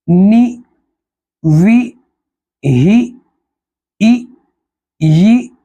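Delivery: each syllable ई, बी, ती sung out long and rising; drawn-out zer vowels. Shown as a demonstration. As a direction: model each kasra sound for students. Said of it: "जैसे हिज्जे करेंगे".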